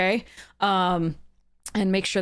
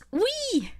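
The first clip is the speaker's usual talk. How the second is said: gleeful squeal. Real, not acted. Streamer squealing to chat, high and light. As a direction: let a giddy squeal slip out.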